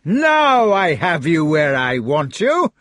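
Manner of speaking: loud, slow gloating bellow; gloating villain. Acted. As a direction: gloat over a trapped foe. Fat knight gloats.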